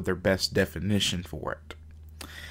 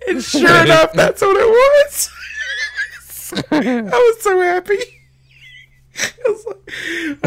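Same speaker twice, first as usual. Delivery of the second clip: laughing; 9 dB louder than usual. Real, not acted. Laughing through the punchline.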